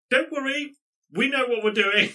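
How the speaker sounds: loud, high shout back; shouted reply. Acted. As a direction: call back across the distance, pitch way up. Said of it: "Selena responds with".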